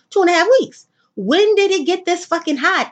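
high, loud exasperated rant; exasperated outburst. Real, not acted. She rants to listeners about the sudden heat, exasperated and high. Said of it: "exasperated about the heat".

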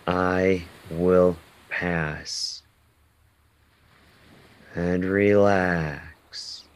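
slow, calm meditation guide; slow calming affirmations with long pauses. Acted. Leads a guided breathing meditation.

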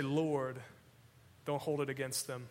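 soft, hushed plea; soft forgiveness. Acted. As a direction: say it gently, letting go. Quoted dying forgiveness.

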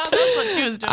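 said through a giggle; laughing. Real, not acted. Laughs at own joke.

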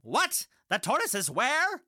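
high startled disbelief; just woke up and can't believe it; surprised. Acted. Hare wakes to bad news.

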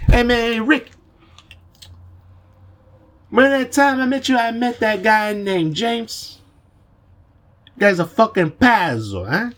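high-pitched put-on Italian accent. Telling Rick about a crazy guy, Italian accent. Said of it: "pretty good Italian accent right there".

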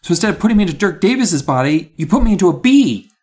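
high, heated rant; angry shouting. A narrator's reading. Gary blaming Miss Carmen for the botched transfer, shouting angrily. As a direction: keep accusing, pitched well up. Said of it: "I shouted angrily".

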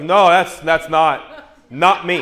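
loud laughing denial, no. Retold or imitated. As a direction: insist it's not you, laughing. Arguing with the chiropractor.

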